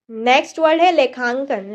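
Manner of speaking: usual speaking voice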